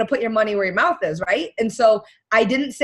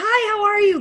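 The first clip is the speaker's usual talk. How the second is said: high, loud bubbly hello; chirpy stranger greeting; 11 semitones higher, 4 dB louder than usual. Retold or imitated. Shy coach imitating a bold greeting to a stranger, bubbly. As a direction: play the outgoing girl at a party. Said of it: "go up to somebody and be like".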